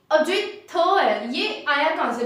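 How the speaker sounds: ordinary delivery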